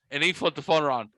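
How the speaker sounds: ordinary talk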